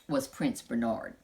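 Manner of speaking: ordinary delivery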